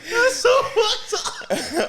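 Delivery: high, slow words squeezed through laughter; laughing hard. Real, not acted. Friend appalled by the joke, helpless laughter. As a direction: say it while helplessly cracking up.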